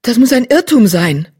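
high, loud dismay; upset outcry. Acted. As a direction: cry out in disbelief. Shocked cat protests her fate.

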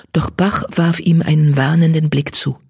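usual speaking voice

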